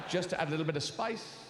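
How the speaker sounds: ordinary delivery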